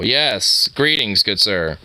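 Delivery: ordinary talk, nothing marked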